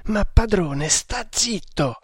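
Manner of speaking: anxious, high-voiced entreaty; pleading. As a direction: beg your master to hush, drawn out. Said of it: "supplicò Kammamuri".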